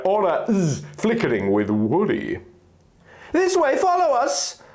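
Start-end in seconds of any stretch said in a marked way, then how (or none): 3.3-4.6 very high fairy voice calling ahead; a tiny sprite leading the way. Acted. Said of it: "Navi yelled".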